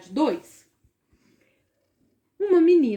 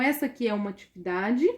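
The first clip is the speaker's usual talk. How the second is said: usual speaking voice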